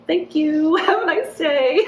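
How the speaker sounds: high, laughing fake politeness; polite goodbye over a laugh; mock polite brush-off. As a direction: sing it sweetly while laughing. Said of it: "And I was like".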